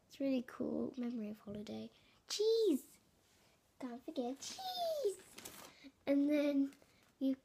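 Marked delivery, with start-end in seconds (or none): 2.3-5.2 high excited cry. Real, not acted. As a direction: call it out gleefully. Calling out for holiday cheese.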